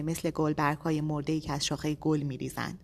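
ordinary delivery

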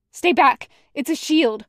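loud, high, commanding warning; urgent warning shout. Acted. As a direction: shout a warning to stay back. Warning friend away from barrier.

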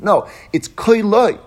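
"Keli" said slowly, high and loud; stress on Keli. Shown as a demonstration. Teacher driving home the Mishnah's word, stressing Keli. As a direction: drag out and punch "Keli". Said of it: "The emphasis on the word Keli".

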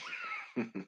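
quiet, brief real laugh; soft chuckle. Real, not acted. Speaker laughs at own silly story, soft chuckle. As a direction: chuckle softly to yourself.

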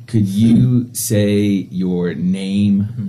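ordinary talk

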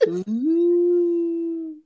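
high, loud, drawn-out ghost moan; ghostly moan. Retold or imitated. Grandma sneaking in to scare him, high ghostly moan. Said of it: "she's all".

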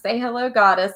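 imitating Albie's prompt. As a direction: lightly mimic someone prompting a goddess to greet. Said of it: "He says".

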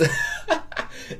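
amused breathy chuckle; short breathy laugh. Real, not acted. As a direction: laugh at his own slip. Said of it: "I just talked in a Persian accent".

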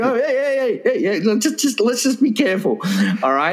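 high, soothing hey hey; placating high voice. Retold or imitated. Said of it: "I'm going".

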